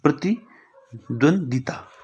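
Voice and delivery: प्रतिद्वंदिता drawn out slowly, each syllable clear; teacher enunciating प्रतिद्वंदिता very slowly. Shown as a demonstration. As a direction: spell out प्रतिद्वंदिता slowly for the class. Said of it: "ऐसे लिखते हैं".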